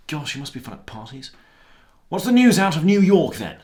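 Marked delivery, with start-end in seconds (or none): none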